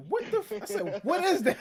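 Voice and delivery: squeaky disbelief, pitch jumping way up with a laugh; incredulous high-pitched laughing what; shocked high yelp. Retold or imitated.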